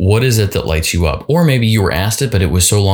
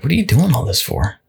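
a lightly voiced, slightly higher doubting inner voice; nagging inner voice; 6 semitones higher than usual. Little inner voice to dieter, a hint of needling doubt. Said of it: "that little voice comes into you and he's like".

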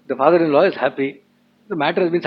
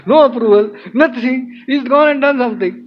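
high-pitched, indignant mock tirade; upset ranting; 6 semitones higher, 4 dB louder than usual. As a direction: act out an exasperated rant, punching each complaint. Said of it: "very upset".

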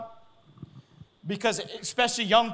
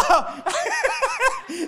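loud falling laugh; can't stop laughing; helpless laughter; 5 dB louder than usual. Real, not acted. Preacher keeps laughing.

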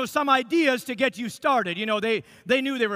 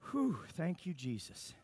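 fading to a much softer, lower breath of relief; relieved sigh; 5 semitones lower, 12 dB quieter, slower than usual. Retold or imitated. Husband finishing the love letter, softly sighing with relief. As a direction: exhale it as if the ordeal is over. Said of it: "I was like".

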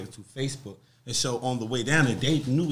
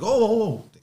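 alarmed; 8 semitones higher, 7 dB louder, slower than usual. Retold or imitated. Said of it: "the guys was like".

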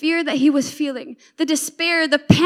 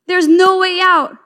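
louder, slow cry of mild panic; acted panic; 3 semitones higher, 7 dB louder than usual. Panicking servant sees he is surrounded, mildly panicked cry. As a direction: play the trapped servant, loud and drawn out. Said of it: "this guy's panicking".

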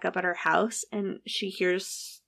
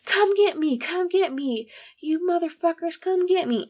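mock yell; 9 semitones higher than usual. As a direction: taunt them from across the street. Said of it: "he's yelling across the street".

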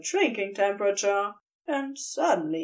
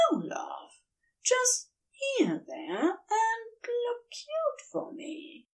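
soft, higher, slower tender angel voice; gently coaxing, pitched up and soft; lightly voiced Aziraphale; 12 semitones higher, 5 dB quieter, slower than usual. A narrator's reading. Aziraphale softly tells Crowley to look cute, higher and slower.

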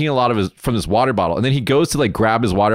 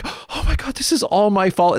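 a hint of melodrama, jumping much higher; mock anguish; 9 semitones higher than usual. Driver blaming himself outside the room, mock anguish. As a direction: lightly ham up a guilty man's despair.

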